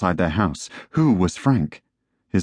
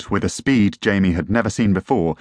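usual speaking voice

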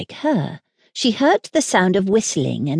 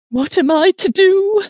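high, louder cry of dismay; distraught nurse; 13 semitones higher, 5 dB louder than usual. A narrator's reading. Nurse unable to stop running, high and distraught. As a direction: wail it, pitched well up.

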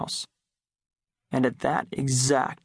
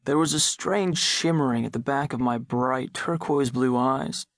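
ordinary delivery, nothing marked

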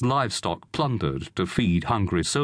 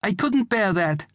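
high, anguished woman's voice; distressed mother; 11 semitones higher than usual. A narrator's reading. Mother dreading son going to war, distressed. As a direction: protest in a raised pitch. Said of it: "cried Juliana".

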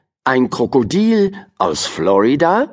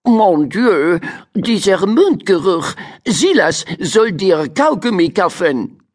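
disgusted French cat; 7 semitones higher than usual. A narrator's reading. Cat Karajan disgusted at crocodile's breath, high and haughty. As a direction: recoil from bad breath, snooty. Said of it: "drehte angewidert seinen Kopf zur Seite".

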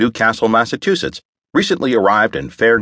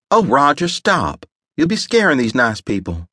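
chiding woman; 7 semitones higher than usual. A narrator's reading. Wife teasing Roger.

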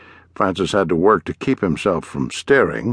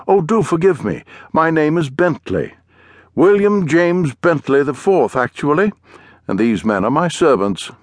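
higher-pitched, mannered English gentleman; English accent; 6 semitones higher than usual. A narrator's reading. Englishman introducing himself and his servants, in a plummy English accent. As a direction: introduce yourself grandly in a high English accent. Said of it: "spoke in a strange accent".

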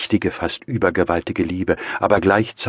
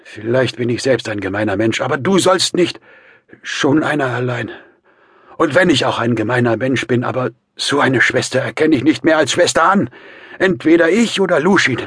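high, loud, desperate insistence; strained, effortful outburst; mustering last strength; 9 semitones higher, 8 dB louder than usual. A narrator's reading. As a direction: force out an ultimatum with all you have left. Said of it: "rief Raskolnikow unter Aufbietung seiner letzten Kräfte".

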